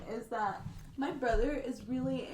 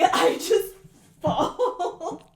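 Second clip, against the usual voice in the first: laughing; 5 semitones higher, 10 dB louder, much slower than usual. Real, not acted. Laughing through retold fall.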